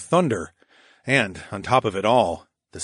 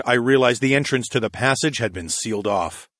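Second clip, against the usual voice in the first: ordinary delivery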